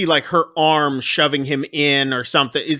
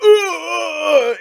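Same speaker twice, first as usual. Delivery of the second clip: a pained high-pitched ooh, much higher, like a stab landing; hammed-up high yelp; 11 semitones higher than usual. Retelling Corner Man getting stabbed, high mock yelp. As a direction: let out a mock cry of pain, high. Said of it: "And Corner Man's like".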